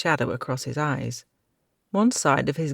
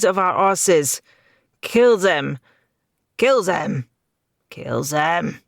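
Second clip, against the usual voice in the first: raving villain; 3 semitones higher, 6 dB louder than usual. A narrator's reading.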